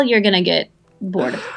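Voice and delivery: ordinary talk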